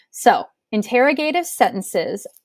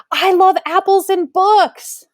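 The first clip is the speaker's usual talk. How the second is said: higher, louder and slower, gushing with excitement; excited exclamation; 9 semitones higher, 4 dB louder, slower than usual. Shown as a demonstration. Teacher demonstrating an exclamatory sentence to students, excitedly. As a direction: show the class real excitement in the voice. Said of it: "I'm just really excited".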